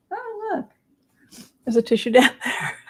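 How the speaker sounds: squeaky, high mock surprise; playful high voice. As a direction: pipe up high and quick. Pretends to spot a tissue.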